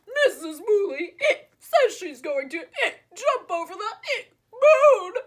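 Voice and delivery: words broken by laughter and hiccups; laughing horse. A narrator's reading. Horse telling the pig while laughing too hard to speak. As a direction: laugh through it, barely getting it out. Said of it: "the horse told the pig".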